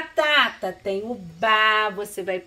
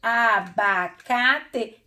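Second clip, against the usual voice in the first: "Abacate" slowly as a, ba, ca, te; syllable by syllable; 3 dB louder, much slower than usual. Shown as a demonstration. Teacher spelling out a word for pupils, syllable by syllable. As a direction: give each syllable of "Abacate" its own beat. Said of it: "tem todas as sílabas".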